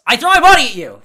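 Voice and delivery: loud mock shout, throwing himself at it. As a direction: belt it like a wild brawler. Retelling coworker's take on wrestling.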